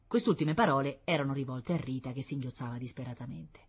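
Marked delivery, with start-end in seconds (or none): none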